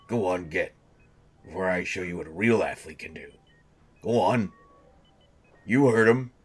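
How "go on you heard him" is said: louder and higher, ordering the crowd to get out; shooing them out. Acted. Patient shooing the medical crew out, loud and sharp. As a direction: raise your voice to clear the room.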